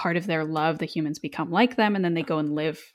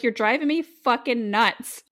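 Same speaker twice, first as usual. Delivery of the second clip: slow, drawn-out exasperation; exasperated; 6 semitones higher, 3 dB louder, slower than usual. Acted. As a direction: at the end of her rope. Exasperated retort acted.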